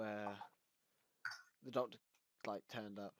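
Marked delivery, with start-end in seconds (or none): none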